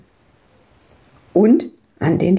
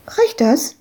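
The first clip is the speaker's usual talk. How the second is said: put-on child voice; 8 semitones higher than usual. Little girl asks Ewke.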